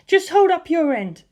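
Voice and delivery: high, bright-voiced young woman, confident; high female character voice, calm and sure; Lisa Lisa's voice. A narrator's reading. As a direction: lift into her high voice and reassure. Lisa Lisa laughs and teases.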